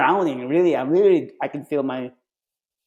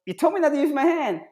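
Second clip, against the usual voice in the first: indignant recruit; 7 semitones higher, faster than usual. Retold or imitated. Recruit arguing back.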